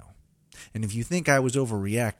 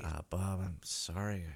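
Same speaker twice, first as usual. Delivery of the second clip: quiet apology; 2 semitones lower, 9 dB quieter, slower than usual. Acted. Apologizing after outburst.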